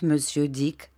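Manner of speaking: plain reading voice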